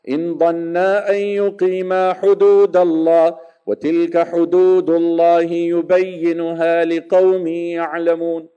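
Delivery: fast, melodic Quranic chant; Quran recitation. Acted. Preacher reciting the verse on triple divorce in melodic chant.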